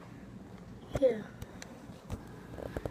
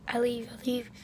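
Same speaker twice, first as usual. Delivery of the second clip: ordinary talk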